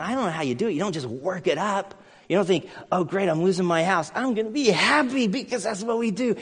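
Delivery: ordinary talk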